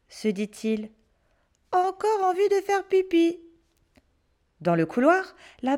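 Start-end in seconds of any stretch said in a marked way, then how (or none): 1.7-3.4 put-on boy's voice. Pierre's quoted thought.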